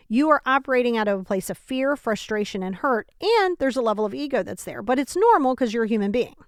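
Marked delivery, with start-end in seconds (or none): none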